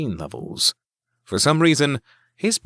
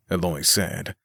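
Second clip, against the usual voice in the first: ordinary delivery, nothing marked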